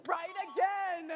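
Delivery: booming prize announcer. Acted. Host confirms answer.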